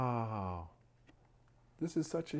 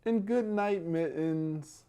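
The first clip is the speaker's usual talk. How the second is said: slow, louder storybook read-aloud; drawing out each rhyming line; bedtime story reading; 5 semitones higher, 6 dB louder, slower than usual. Acted. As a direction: read it out like a picture book for little ones.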